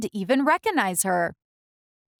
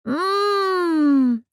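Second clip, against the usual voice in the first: delighted mmm; 8 semitones higher, 3 dB louder than usual. Acted. Camilla tasting beans.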